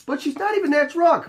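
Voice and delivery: high-pitched mock protest; protesting husband. Husband arguing with doorman about drunk wife, mock protest. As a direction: imitate a husband objecting, voice way up.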